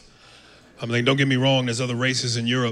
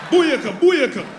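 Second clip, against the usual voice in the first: high loud dancehall shout; Jamaican cheer; 11 semitones higher, 6 dB louder, slower than usual. Retold or imitated. Jamaican woman cheering during sex, loud dancehall shout. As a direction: bellow it like a dancehall crowd. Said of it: "Jamaican broad".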